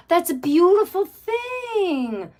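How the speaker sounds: high and slow, full of awe; slow, moved high voice; touched. Real, not acted. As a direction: let it melt out, deeply moved. Moved by a viewer's story.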